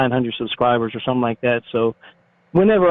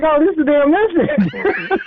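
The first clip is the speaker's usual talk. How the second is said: laughing; 14 semitones higher than usual. Retold or imitated. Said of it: "I'm like".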